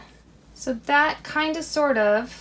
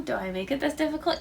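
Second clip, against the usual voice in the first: ordinary delivery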